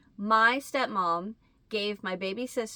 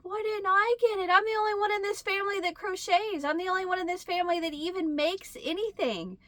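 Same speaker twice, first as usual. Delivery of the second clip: pitched way up, hurt and whining; high whiny inner voice; 10 semitones higher than usual. Retold or imitated. To herself, jealous that sister got grandma's afghan, high whine. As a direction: inner voice whining it should have been mine. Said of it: "a part of me was like".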